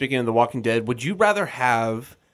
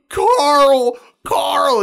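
much higher, much louder anguished Carl calls; retelling Rick's distraught yell for Carl; 13 semitones higher, 7 dB louder than usual. Retold or imitated. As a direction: cry out for your son in a panic. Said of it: "He's, like, crying, freaking out".

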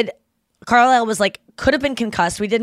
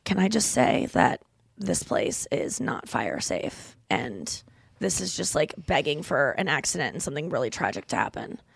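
hushed warning; 5 semitones lower, 7 dB quieter than usual. Retold or imitated. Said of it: "And she was like".

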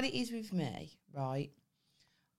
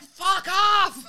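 high, loud retold shout; mock yell; 14 semitones higher, 11 dB louder than usual. Retelling how she yelled at security guards, mock angry. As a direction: bark it at the guards.

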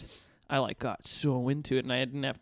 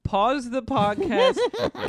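laughing; 9 semitones higher, 7 dB louder, much slower than usual. Real, not acted. Asks for vodka skull, laughing.

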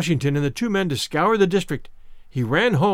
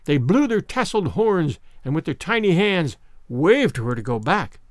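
ordinary delivery

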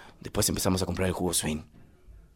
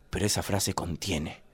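usual speaking voice

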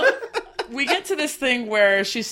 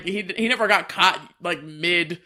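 ordinary delivery

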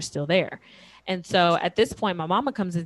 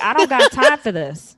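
higher and much louder, fed up; exasperated yell; 7 semitones higher, 12 dB louder than usual. Retold or imitated. Brushing off her kneeling husband, a loud fed-up yell. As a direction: snap it out like you're done with him. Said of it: "And I'm like".

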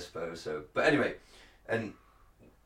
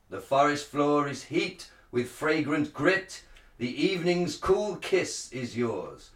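Shakespearean actor; 5 semitones higher than usual. Shown as a demonstration. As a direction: proclaim it like a druid priest. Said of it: "like a Shakespearean, um, uh, actor".

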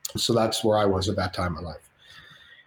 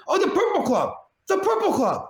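much higher put-on De Niro voice; hammed-up De Niro calling out; De Niro impression. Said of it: "Robert De Niro goes by Bobby".

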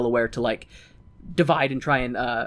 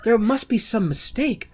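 higher, slow mock protest; lightly voiced protesting reverend; 7 semitones higher than usual. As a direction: object slowly, pitched up, in imitation. Quotes the Reverend's great line.

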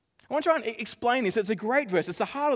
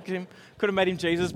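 ordinary talk

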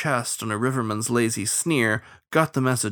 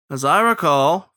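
raised, louder retort; shouted back; 6 semitones higher, 6 dB louder than usual. A narrator's reading. Captain answering the starling from his boat, shouted back. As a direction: throw the phrase back at them. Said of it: "Fitzalmo shouted back".

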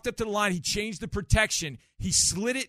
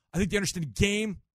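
usual speaking voice

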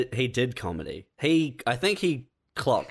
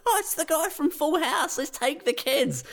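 a mock chirpy mum, much higher; naive parents. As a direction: audience parents eagerly planning a family outing. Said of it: "people would come out and be like".

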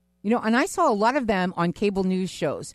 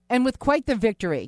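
ordinary delivery

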